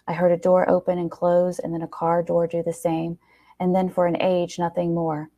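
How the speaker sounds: usual speaking voice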